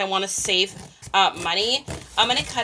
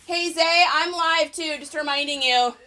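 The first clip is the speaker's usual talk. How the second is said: calling out; 7 semitones higher than usual. Real, not acted. Calling out to son.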